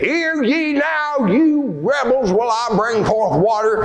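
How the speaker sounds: a high, mock-furious rebuke to the rebels; angry Moses. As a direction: scold the rebels like an angry prophet. Moses angrily scolds the people.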